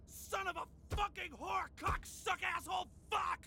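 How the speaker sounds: high, shouted cursing tirade; raging sore-loser rant; furious swearing outburst. Acted. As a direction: explode with each curse punched out. Furious outburst after losing to rival.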